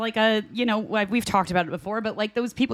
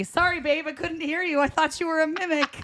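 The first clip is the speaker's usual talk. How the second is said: a lightly voiced husband making a sly excuse, higher; imitating husband; 7 semitones higher than usual. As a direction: play the teasing husband, pitched up. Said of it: "my husband's gonna use that".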